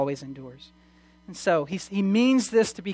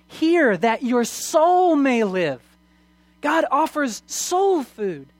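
impassioned preaching; 4 semitones higher, 4 dB louder than usual. Acted. Preacher offering God's free invitation to the congregation, impassioned. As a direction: proclaim it to the congregation with rising intensity. Preacher builds to full force.

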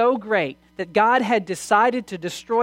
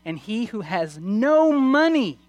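impassioned preaching; 4 semitones higher, 4 dB louder than usual. Acted. Preacher offering God's free invitation to the congregation, impassioned. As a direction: proclaim it to the congregation with rising intensity. Preacher builds to full force.

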